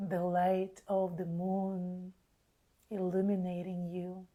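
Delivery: slow, spacious meditation voice with long pauses; guided meditation. Acted. Healer easing live listeners into relaxation under the full moon, slow guided meditation. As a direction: guide them gently into relaxation, unhurried.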